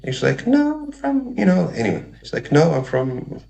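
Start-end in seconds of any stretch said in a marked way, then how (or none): 0.5-1.7 very high falsetto imitation of a sweet girl; sweet girlish voice. Girl answering doubts that she's a girl, imitated sweet high voice. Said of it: "she had the sweetest voice". 2.5-3.4 lightly imitated sweet girl, pitched up; sweet girlish voice. Girl telling the host where she's from, lightly imitated sweet voice. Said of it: "she had the sweetest voice".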